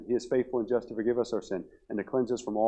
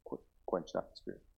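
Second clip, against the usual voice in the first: solemn refrain; 4 semitones lower, 10 dB quieter than usual. Real, not acted. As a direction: drop right down and say it simply. Quiet refrain answering questions.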